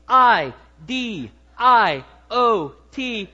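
each letter slow and loud, spaced apart; spelled out letter by letter. Shown as a demonstration. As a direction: spell it out slowly for the congregation. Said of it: "Here are the five letters".

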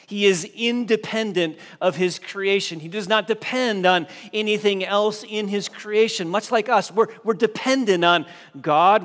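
a rising, fervent sermon crescendo; preaching at full force. Acted. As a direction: build and climb to a ringing climax.

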